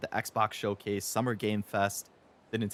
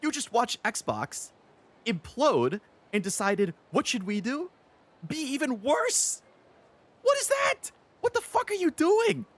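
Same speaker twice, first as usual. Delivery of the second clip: starts high and slow, ends in a very high, loud shriek; building to a shriek; 14 semitones higher, 4 dB louder than usual. Retold or imitated. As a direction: climb from disbelief to a screaming outburst. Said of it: "I'm like".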